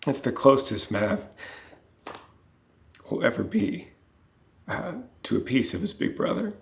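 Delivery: choked up, voice cracking high; tearful, barely holding it together; voice breaking. Real, not acted. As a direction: fight back tears as the words come. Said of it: "Sorry, I didn't mean to get emotional".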